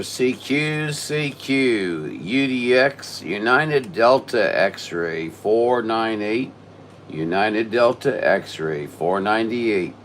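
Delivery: loud, slow deliberate callsign; radio call. Real, not acted. Ham operator calling CQ with callsign, slow loud radio call. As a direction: spell out the callsign slowly and loud over the air.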